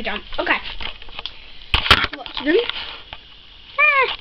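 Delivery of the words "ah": high startled ah; surprised shout. Real, not acted. Child yelping as the toy jumps. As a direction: yelp in surprise.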